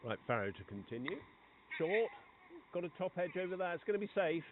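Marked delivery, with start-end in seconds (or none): 1.8-4.5 higher, louder live commentary on a top edge; rising excitement as the ball flies; excited play-by-play. Real, not acted. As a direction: call the action as it happens. Live action, ball flies near boundary.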